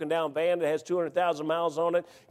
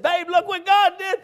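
high, slow, thrilled Babe, look what God did; excited announcement; 10 semitones higher, 5 dB louder than usual. Retold or imitated. Showing his wife the check, slow and thrilled. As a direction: stretch it out, overjoyed, much higher. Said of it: "didn't even smile".

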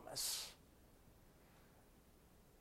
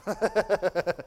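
laugh; 3 semitones higher than usual. Real, not acted. Laughs at own impression.

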